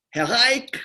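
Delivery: high, formal "Herr Reich" like a Swiss secretary; lightly voiced Swiss receptionist; Swiss caller. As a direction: greet him the way a Zurich office would.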